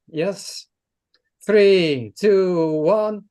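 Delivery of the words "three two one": loud rising countdown; countdown call. Real, not acted. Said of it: "shall I do the countdown".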